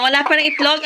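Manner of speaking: normal talking voice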